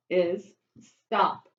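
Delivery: ordinary talk, nothing marked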